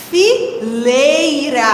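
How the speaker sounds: "fileira" very slow, split as fi, lei-ra; syllable by syllable. Shown as a demonstration. Teacher tests the rhyme for children, syllable by syllable. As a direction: sound out "fileira" with a long "lei". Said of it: "Se eu parar bem pra pensar".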